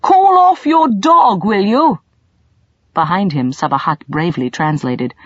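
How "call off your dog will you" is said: much louder and higher, slow urgent yell; urgent shout. A narrator's reading. Colin facing the snarling dog, urgent shout. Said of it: "Colin shouted".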